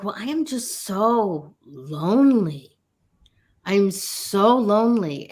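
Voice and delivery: slow, hammed-up self-pity; a touch of a whiny lonely sigh; mock lonely lament. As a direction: drag out the loneliness, half joking. Texting daughter a mock lament.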